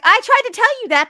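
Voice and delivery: high, loud, exasperated protest; retold I-told-you-so. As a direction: exclaim it like you've been proved right. Acted quote, protesting I-told-you-so.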